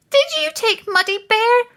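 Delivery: high, loud squeak. A narrator's reading. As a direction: squeak out the question nervously. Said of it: "they squeaked".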